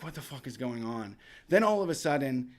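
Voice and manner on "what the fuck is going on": low, hushed disbelief; soft, low retold bewilderment; bewildered quiet reaction. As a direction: mutter it low, as if woken by noise. Woken by noise in the night.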